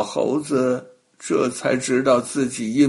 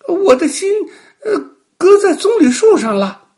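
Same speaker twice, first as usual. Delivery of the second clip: monkey fake whimper; 9 dB louder, faster than usual. Monkey pretends his heart is elsewhere.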